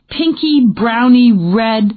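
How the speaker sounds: feeling for the right word, drawn out; groping for words. Acted. Dana groping to describe the paint color, slow and searching. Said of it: "he repeated".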